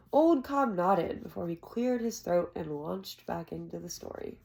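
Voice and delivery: ordinary delivery, nothing marked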